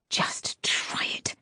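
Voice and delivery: breathy unvoiced whisper, slow. A narrator's reading. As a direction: hold the blade and breathe the threat. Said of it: "she whispered".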